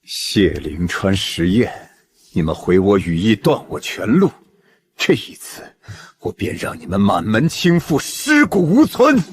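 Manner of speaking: slow, seething vow of revenge; vengeful villain. Acted. Villain vows bloody revenge. (咬牙切齿地发狠报复)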